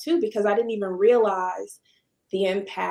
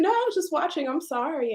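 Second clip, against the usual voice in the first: higher, lightly voiced apology; a bit embarrassed, pitched up; sheepish reassurance; 8 semitones higher, faster than usual. Owner caught staring at the painter, sheepish and higher. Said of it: "And I said".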